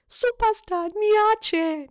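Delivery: high, loud, pleading cry; mock desperate plea to a friend attacking her; pleading quote. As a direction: beg her to leave you alone, shrill-free but high. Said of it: "I was just like".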